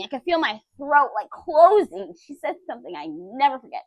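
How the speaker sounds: normal talking voice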